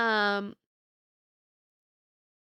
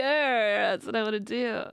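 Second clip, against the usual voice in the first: ordinary delivery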